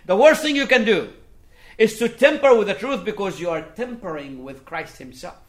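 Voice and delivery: loud, high-pitched sermon delivery; forceful preaching. Real, not acted. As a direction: preach it out with full conviction. Preaches a warning.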